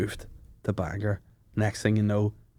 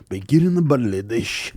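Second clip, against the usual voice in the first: put-on Spanish-accented perfume ad voice; hammed-up Spanish perfume advert; Spanish narrator voice. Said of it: "the Spanish narrator voice".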